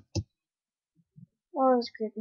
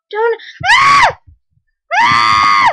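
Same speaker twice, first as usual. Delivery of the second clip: very high, loud shriek of fright; startled scream; 10 semitones higher, 9 dB louder, much slower than usual. Real, not acted. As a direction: scream as the closet opens. Closet opened, mob appears.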